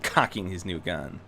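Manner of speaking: ordinary delivery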